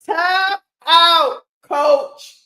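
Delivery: loud, high jeering call to the coach. Real, not acted. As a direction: yell it like a taunt from the stands. Fan calls for opponent timeout.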